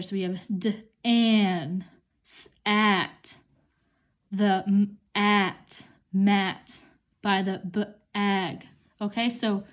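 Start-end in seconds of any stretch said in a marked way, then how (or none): 0.6-8.7 "d, an" and "m, at" split into onset and rime; sounding out words. Shown as a demonstration. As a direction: break "mat" into "m" then "at", stretching the A. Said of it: "these A sounds here".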